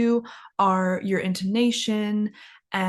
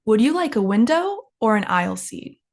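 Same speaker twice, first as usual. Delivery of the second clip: "window" swings up, "aisle seat" settles down; up then down intonation. Shown as a demonstration. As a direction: model two-option question, rising on "window", falling on "aisle seat". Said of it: "the first option goes up".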